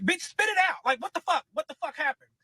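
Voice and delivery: retold shout at the stalling boyfriend; exasperated shout. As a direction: yell at him to spit it out. Demanding the confession.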